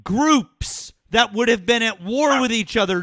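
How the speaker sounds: high, loud, slowed-down screaming rage at the caller; furious shout. Real, not acted. Host screams in exasperation at an ignorant caller, furious shout. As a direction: bellow it in disbelief, out of patience.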